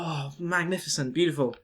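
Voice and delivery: soft, admiring murmur; hushed awe. Retold or imitated. Wowed by the Eiffel Tower, murmuring softly. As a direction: gaze up and breathe it out. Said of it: "you're like".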